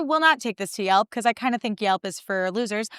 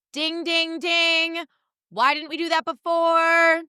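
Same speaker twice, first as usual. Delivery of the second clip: high, slow sing-song sarcasm; sarcastic ding ding; 9 semitones higher, much slower than usual. Pretend. Speaker mocking the restaurant's late fix, high sing-song. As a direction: ring it out like a game show.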